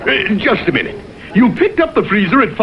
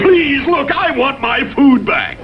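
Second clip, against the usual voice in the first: ordinary delivery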